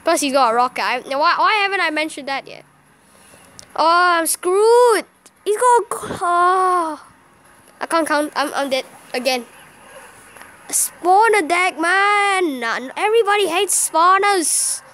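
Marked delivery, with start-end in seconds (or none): 3.7-7.1 higher, slow, drawn-out groaning wail; despairing wail. Real, not acted. Player realising he is beaten, despairing wail. 10.7-14.8 higher, drawn-out exasperated whine; whining complaint. Real, not acted. Player griping about a spawner deck, whining.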